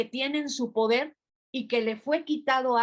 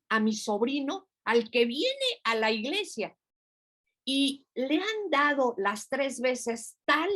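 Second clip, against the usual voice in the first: usual speaking voice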